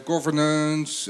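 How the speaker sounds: usual speaking voice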